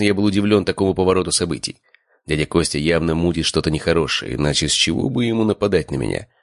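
ordinary delivery, nothing marked

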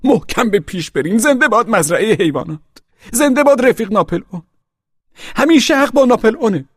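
high, loud proclamation, building; rousing slogans. A narrator's reading. Pig quoting Boxer's last words to animals, rousing high proclamation.

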